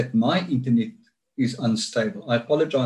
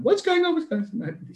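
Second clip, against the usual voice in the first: worried friend. Retold or imitated. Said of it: "on the phone immediately".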